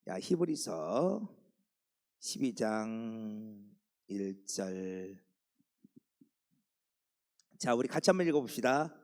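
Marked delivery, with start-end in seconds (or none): 0.1-5.2 1 drawn out. Shown as a demonstration. Drawn-out teasing reference.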